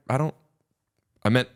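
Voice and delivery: ordinary talk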